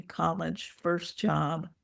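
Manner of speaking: plain reading voice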